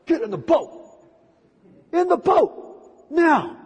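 firm command that won't take no; commanding Jesus. Pretend. Jesus ordering hesitant disciples into the boat, insistent.

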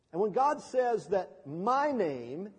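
normal talking voice

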